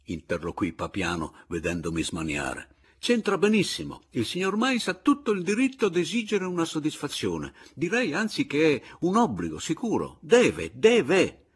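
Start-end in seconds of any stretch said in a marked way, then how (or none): 3.0-11.4 high, loud, emphatic argument; heated insistence. A narrator's reading. Papiano insists to Anselmo on the duty, high and emphatic. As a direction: drive it home, punching the last words. Narrator frantic, insisting on his right.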